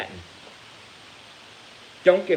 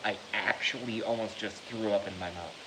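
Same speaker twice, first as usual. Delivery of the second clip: quiet sincere; 2 semitones lower, 7 dB quieter than usual. Real, not acted. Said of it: "I'm not being dramatic".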